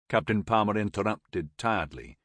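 plain reading voice

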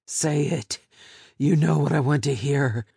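a hint of a rough captor, pressing her; lightly voiced menacing captor; menacing captor demand.

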